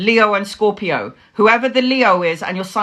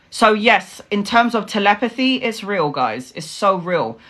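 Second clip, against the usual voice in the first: ordinary talk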